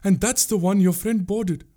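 high, distressed woman's voice; urgent revelation. A narrator's reading. Pungurali telling the prince about his friend, distressed. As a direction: drive the bad news home, pitched high.

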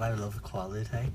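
usual speaking voice

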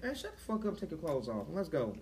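low, flat, bossy order; commanding demo. Shown as a demonstration. Showing friends how he told her off, low and commanding. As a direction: boss her around, calm and cold. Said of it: "Show me how you did".

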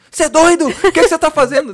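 high, loud scolding shout; alarmed mother. Retold or imitated. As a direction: yell at him like a scared mom. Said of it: "a minha mãe".

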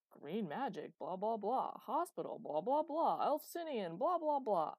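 loud, swooping sing-song blahs; mocking quote. A narrator's reading. Chloe throwing Master's words back, mocking. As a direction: parrot it scornfully, each blah bigger.

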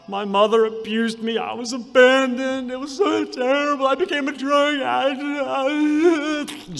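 high, strained mock sob; retold sobbing. Woman telling her painful story at a seminar, sobbing. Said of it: "Snot everywhere".

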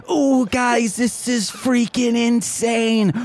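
high, drawn-out hype voice; Khoiset. Acted. Host hypes the final match, high and thrilled. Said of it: "The Khoiset says".

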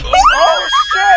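high shocked yelp; sudden panicked cry; startled yell. Real, not acted. Near crash in the car.

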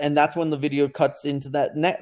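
ordinary talk, nothing marked

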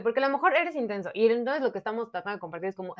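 ordinary talk

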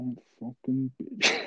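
slow, soft grumble, acted out; muttered insult. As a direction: curse under your breath as you walk off. Muttered insult while walking off.

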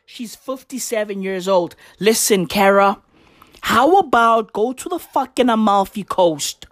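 usual speaking voice

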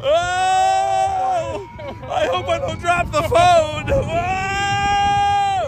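high, shouted nervous excitement; thrilled. Real, not acted. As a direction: scream through the ride. Riding coaster, scared of drop.

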